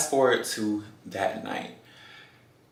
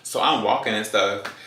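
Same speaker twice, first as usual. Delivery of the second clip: ordinary talk, nothing marked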